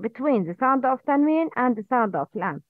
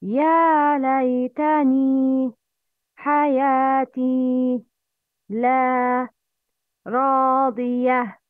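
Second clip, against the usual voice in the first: slow, each long vowel held for two beats; lengthened vowels; slower than usual. Shown as a demonstration. Teacher models mad tabi'i for students to repeat. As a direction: stretch the mad letters in يا and حياتي evenly. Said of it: "Please repeat after me".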